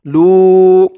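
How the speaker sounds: লু stretched to one alif length; long lu. Shown as a demonstration. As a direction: hold the vowel of লু for a full count. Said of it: "লুও এক আলিফ টান হবে".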